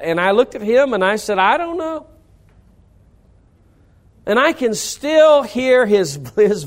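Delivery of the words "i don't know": put-on sheepish kid voice. Kid answering his dad.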